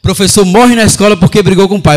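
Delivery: very loud mock headline; jokey, booming news announcement. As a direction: proclaim the imagined headline at full volume. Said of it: "a reportagem ia ser essa".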